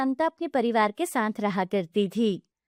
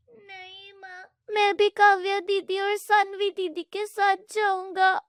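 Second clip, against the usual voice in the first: put-on high child voice begging; pleading little boy; 9 semitones higher than usual. Boy begging his mother to go with his cousins, high child voice. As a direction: beg mother to let him go.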